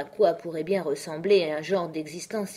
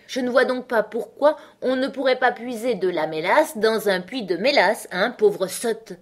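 louder, higher, slowing on the insult; scornful retort; 5 semitones higher, 5 dB louder than usual. A narrator's reading. Hatter explaining the treacle well to Alice, scornful. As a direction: lecture scornfully, then drag out the jibe.

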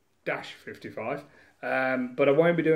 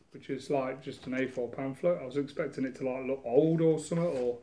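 ordinary talk

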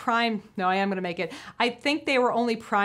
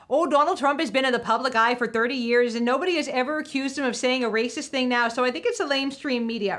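mock folksy politician voice, slightly raised; Sarah Palin; 3 semitones higher than usual. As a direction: put on a perky politician impression. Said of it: "had to get my Sarah voice on".

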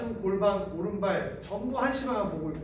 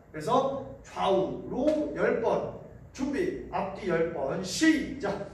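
normal talking voice

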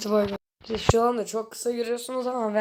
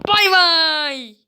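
sing-song goodbye; 5 semitones higher, 6 dB louder than usual. Real, not acted. Signing off the video.

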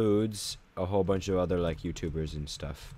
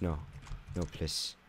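ordinary talk, nothing marked

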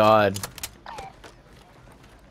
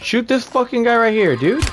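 urgent shout; 9 semitones higher than usual. Real, not acted. Urgent callout under fire.

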